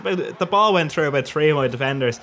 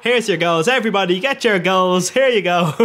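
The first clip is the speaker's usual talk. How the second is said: raised, louder street hawker call; leaflet-hander's patter; 5 semitones higher, 5 dB louder than usual. Acted. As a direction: call out like handing out freebies. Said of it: "the lads on the street who hand out leaflets".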